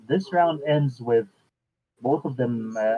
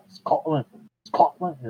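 trying out a Scottish accent; repeated rough Scottish attempt; 3 semitones higher, slower than usual. Shown as a demonstration. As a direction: practise it in unconvincing Scottish. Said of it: "that's not gonna be right".

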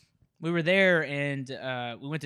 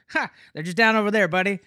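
loud, higher-pitched mock country guy; country father-in-law; 7 semitones higher, 9 dB louder than usual. Storyteller imitating father-in-law giving directions, lightly voiced country guy. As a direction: lightly play a hearty country helper. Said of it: "he goes by Country".

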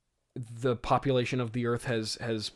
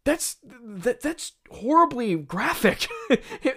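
ordinary delivery

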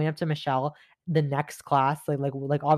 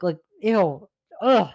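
real grossed-out ew and ugh; disgusted ew; 3 semitones higher, 4 dB louder, slower than usual. Real, not acted. Remembering Nigel from gym class, grossed-out groan. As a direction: recoil with disgust. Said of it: "Thinking about him, I'm just like, ugh".

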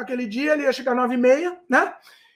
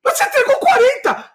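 much higher, indignant cry; outraged yell; 10 semitones higher, 3 dB louder than usual. Retold or imitated. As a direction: yell in disbelief at the wrong payment. Grito indignado ao ver quarenta. (grito indignado com a esposa)

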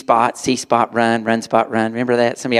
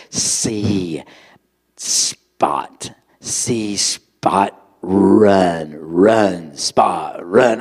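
slow, lower, word-by-word struggling reader; halting first reader; 4 semitones lower, much slower than usual. Retold or imitated. Dobie reading aloud to his tutor, lower and halting. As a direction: sound each word out haltingly like a first grader. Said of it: "he goes".

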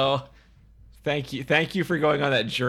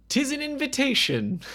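hammed-up olde English British narrator, higher and louder; mock British accent; 12 semitones higher, 5 dB louder than usual. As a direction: announce it like a theatrical British storyteller. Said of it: "Nobody in this movie has a British accent".

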